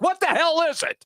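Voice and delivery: exasperated challenge. Real, not acted. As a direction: throw the question out in exasperation. Host rants at coach's contradiction.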